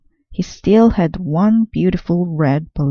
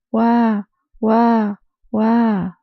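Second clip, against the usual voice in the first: put-on baby crying wah; 5 semitones higher, much slower than usual. A narrator's reading. Narrator wailing as the crying baby. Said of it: "still she cried".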